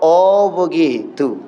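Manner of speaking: drawn-out, low "oh begitu"; slow realization. Retold or imitated. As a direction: let it dawn on you slowly. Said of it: "kata Umar bin Khattab".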